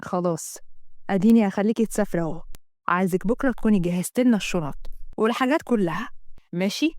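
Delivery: low, placating male lead; compliant devoted lover. Acted. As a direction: give in to her tenderly.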